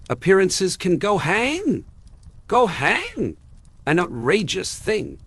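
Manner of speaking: high, heated uncle's outburst, pitch jumping up; violent outburst. A narrator's reading. Uncle rages at his niece's defiance, heated high outburst. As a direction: spit it out like a furious old uncle. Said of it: "said Uncle Benjamin violently".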